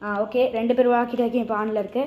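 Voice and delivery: ordinary talk, nothing marked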